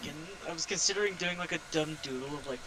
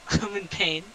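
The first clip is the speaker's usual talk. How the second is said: laughing; 4 semitones higher, 5 dB louder, slower than usual. Real, not acted. Laughing at dark maze run.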